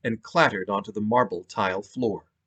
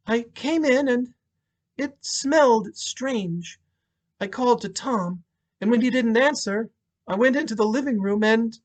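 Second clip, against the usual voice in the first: widow recalling the horror; shaken widow; 12 semitones higher than usual. Acted. Monica recounts finding husband.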